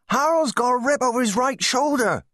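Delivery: high, strained calling out; alarmed cry. A narrator's reading. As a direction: cry the alarm across a field. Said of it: "cried Joan".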